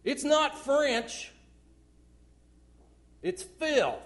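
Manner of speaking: loud, high, slow It's not French with NOT hit hard; emphatic loud. Real, not acted. Preacher scolding the excuse, loud emphatic denial. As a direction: punch NOT. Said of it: "'Cause it isn't French".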